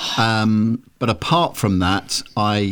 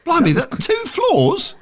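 surprised; slower than usual. Real, not acted. Colleague back surprisingly fast.

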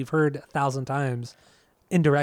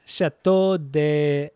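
slow, drawn-out mock French accent; attempted French accent; 3 dB louder, much slower than usual. Shown as a demonstration. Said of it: "Say it in French".

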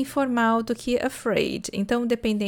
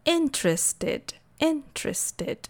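"Interested" slowly as IN-trested, higher pitched; first syllable stressed; 5 semitones higher, slower than usual. Shown as a demonstration. Teacher repeating "Interested" for students, first syllable long and stressed. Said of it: "A primeira sílaba é mais forte e mais longa".

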